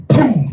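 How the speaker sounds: loud high boom; gunshot imitation. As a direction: mimic a gunshot.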